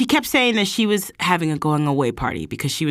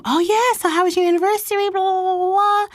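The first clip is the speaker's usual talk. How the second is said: high, fast, sing-song nosy friend voice; friends' prying, high-pitched chatter; imitating gushing friends. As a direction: mimic friends chirping questions at her. Friends asking about anniversary.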